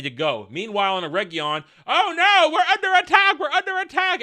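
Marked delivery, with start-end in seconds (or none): 1.9-4.2 high, loud hammed-up panic; mock freaking out. Townsfolk in Eregion under attack, mock panicked shriek. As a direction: cry out in comic alarm. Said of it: "Everybody starts freaking out".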